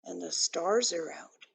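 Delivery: plain reading voice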